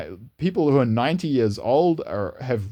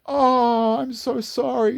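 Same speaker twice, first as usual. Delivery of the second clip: put-on much higher, slow, tender old lady; sympathetic old grandmother; 14 semitones higher, much slower than usual. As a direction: say it like a ninety-year-old grandmother full of empathy. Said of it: "she went".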